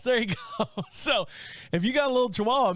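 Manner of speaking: normal talking voice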